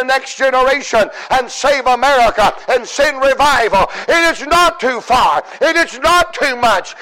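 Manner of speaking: driving, building revival preaching; full-force preaching. Real, not acted. Preacher urging the church to go get God, rising full-force preaching. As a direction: build the repeated phrases to a shouted climax.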